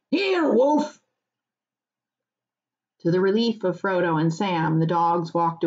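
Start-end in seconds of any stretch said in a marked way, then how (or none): 0.1-1.0 high dog-calling cry. Acted. Farmer calling Wolf to heel, crying out. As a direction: summon the last dog. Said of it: "he cried".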